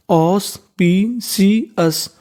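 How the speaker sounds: very slow ऑस, पी; slow syllable building. Shown as a demonstration. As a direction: stretch each syllable for the class. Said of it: "तो देखिए meaning कैसे बनती है". (बहुत धीमे अक्षर जोड़ना)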